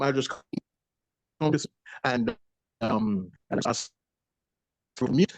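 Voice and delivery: choppy, faint bad connection; breaking up. Real, not acted. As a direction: voice dropping out over a bad line. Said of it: "breaking up".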